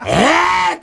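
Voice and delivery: high, loud mock shriek of shock; mock shocked cry. The lady boss opening the box, a high mock shriek. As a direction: squeal it like a scandalised lady.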